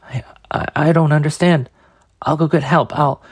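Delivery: put-on high, shaky frightened boy; panicked stammer.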